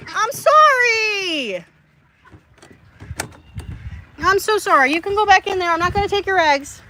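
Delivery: very high-pitched pet-talk apology; pleading apology. Real, not acted. Owner telling the hen she won't take eggs, high apologetic voice. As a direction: soothe and plead, voice pitched right up.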